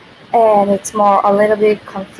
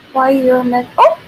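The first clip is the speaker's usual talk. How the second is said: ordinary talk, nothing marked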